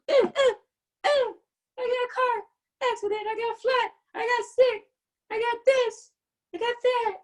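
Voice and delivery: high-pitched whiny complainer voice; mock whining. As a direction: whimper through a list of woes, hammed-up. Mimics someone whining about setbacks.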